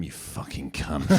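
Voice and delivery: low, slow, darkly muttered insult; imagined audience member insulting him under his breath; rude heckler. Retold or imitated. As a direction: drop low and growl it out slow.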